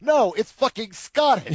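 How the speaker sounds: high, slow, emphatic retort; incredulous, stretched-out debunking; exasperated correction. Acted. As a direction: draw it out in disbelief. Mock-exasperated retort to quoted moviegoers.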